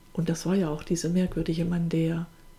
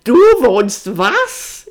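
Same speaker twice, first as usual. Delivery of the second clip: high, slow, very loud outraged question; shrill disbelief; 5 semitones higher, 13 dB louder, much slower than usual. A narrator's reading. Sister shocked at her brother's living arrangement, shrill. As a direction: shriek it in disbelief, drawn out. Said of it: "ihre Stimme wurde schrill".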